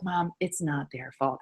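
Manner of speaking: retold gentle correction of Mom; daughter's calm protest, imitated. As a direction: quietly defend them, as the daughter. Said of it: "she's like".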